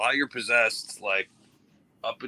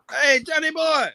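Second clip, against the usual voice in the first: playful greeting; 5 dB louder than usual. Acted.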